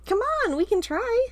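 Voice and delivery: put-on high, loud little-boy whine; pleading kid brother. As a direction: beg like a cheeky younger brother. Carl urging.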